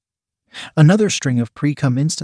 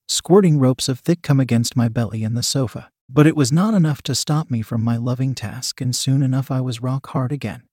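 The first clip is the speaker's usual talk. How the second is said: ordinary delivery, nothing marked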